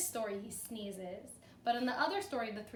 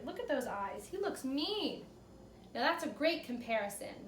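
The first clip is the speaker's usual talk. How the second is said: ordinary delivery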